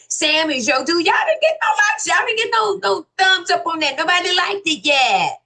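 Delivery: high, fast, shouted teasing; playful high shout at a commenter with no likes. Real, not acted. Teasing Sam about no likes.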